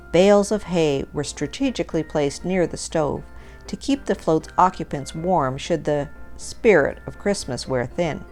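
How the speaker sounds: ordinary delivery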